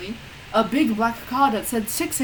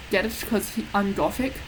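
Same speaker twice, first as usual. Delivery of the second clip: plain reading voice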